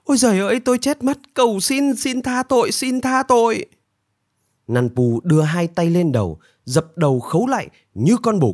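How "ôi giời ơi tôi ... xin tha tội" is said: very high, pleading wail; high-pitched frantic begging; panicked wailing. A narrator's reading. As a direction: beg desperately for forgiveness, pitched right up. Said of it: "Nanpu lại gào lên".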